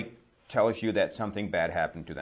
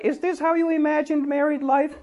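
much higher, a put-on cartoon character voice; 5 dB louder than usual. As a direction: squeak it out like a cartoon husband. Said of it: "And he's like".

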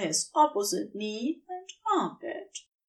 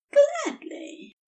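high, breathy and eager; breathed out. Acted. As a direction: breathe the word out. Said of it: "Aziraphale breathed out".

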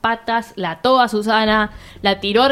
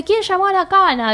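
angry demand; 8 semitones higher than usual. Retold or imitated.

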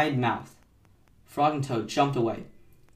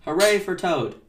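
loud, higher-pitched hooray; raised, rooting shout; shouted cheer; 6 semitones higher, 6 dB louder than usual. A narrator's reading. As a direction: cheer from the audience. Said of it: "as he shouted".

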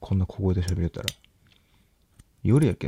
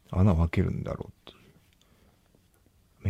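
whispered aside; much slower than usual. Real, not acted. Muttering to himself.

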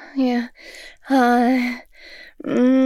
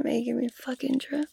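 much softer unvoiced whisper; 10 dB quieter than usual. Teasing Daddy in a soft whisper. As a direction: drop to a hush.

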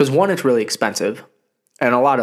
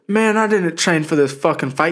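mock Tyson; 6 semitones higher, 4 dB louder than usual. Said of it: "He goes".

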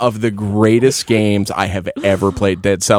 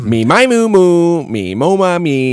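high sung warm-up syllables; vocal warm-up; 7 semitones higher, slower than usual. Shown as a demonstration. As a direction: warm up the voice like a singer. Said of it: "Vocal exercises, everybody".

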